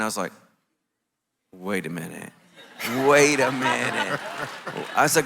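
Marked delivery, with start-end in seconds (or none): 1.5-2.3 stunned disbelief. Retold or imitated. Retold reaction of stunned disbelief. 3.1-4.2 drawn-out, slow double take; mock disbelief. Retold reaction of stunned disbelief.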